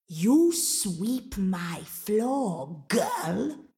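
very slow, stretched-out command; menacing ogress. Acted. Mama Draga ordering Angelina to sweep, slow and menacing. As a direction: draw every word out as a threat.

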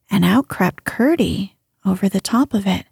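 usual speaking voice

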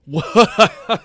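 high, startled one-word question; incredulous. Real, not acted. Co-host reacting to the outlandish casting idea, incredulous. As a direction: blurt it out in disbelief.

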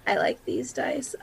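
breathy whisper to self; whispered aside. Real, not acted. Player pleased with her dice, whispering to herself. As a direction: murmur it just for yourself. Player after big roll.

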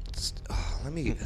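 soft whispered aside; whispering. Real, not acted. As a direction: whisper it under breath.

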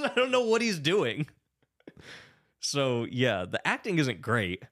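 normal talking voice

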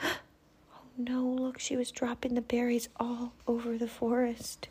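hushed gasp then whispering; dramatic whisper. Acted. Narrator whispers to the child that the berries fell out, hushed and dramatic. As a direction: share the secret in a hush.

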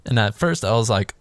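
usual speaking voice

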